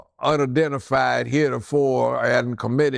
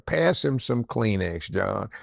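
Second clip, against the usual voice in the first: ordinary delivery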